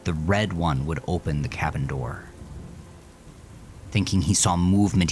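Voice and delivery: ordinary delivery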